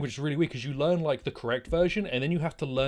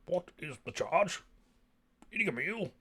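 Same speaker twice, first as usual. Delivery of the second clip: very dramatic fellow; 6 semitones higher, 4 dB quieter than usual. Retold or imitated. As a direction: imitate a hammy orator, questions swooping. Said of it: "He was a very dramatic fellow".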